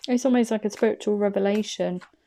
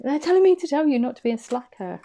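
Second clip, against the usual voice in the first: emphatic; 6 semitones higher, 5 dB louder, faster than usual. Real, not acted. Insisting the message is real.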